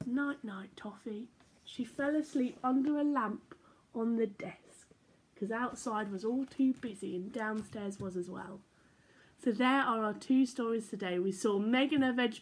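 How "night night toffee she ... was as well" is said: bright, lilting storybook voice; reading to children. A narrator's reading. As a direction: read it like bedtime story time for little ones. Reading picture book aloud.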